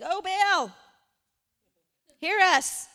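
loud, high cry out to a god; calling on a god. Acted. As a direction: call out imploringly, with a long pause between cries. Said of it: "they called on the name of Baal".

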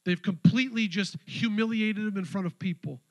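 loud, high, impassioned preaching; preaching at full force. Acted. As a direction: preach it out with fire.

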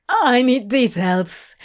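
hoarse breathless croak. A narrator's reading. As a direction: croak it out, winded. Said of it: "he croaked".

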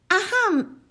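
high timid throat clear; a tiny nervous ahem; squeaky mouse clearing his throat. Acted.

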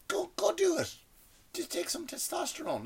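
high, strained whisper; hushed urging. Pretend. Urging aging actors to get in shape, high whisper. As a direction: egg them on in a whispery high voice.

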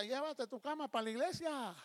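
high-pitched scolding shout, acted; mock yell. Husband locking his wife out, mock angry yell. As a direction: holler it like an annoyed spouse. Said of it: "Ya te grita".

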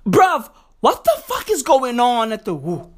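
usual speaking voice

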